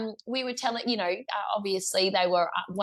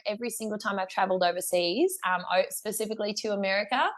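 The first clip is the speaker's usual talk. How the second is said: ordinary talk, nothing marked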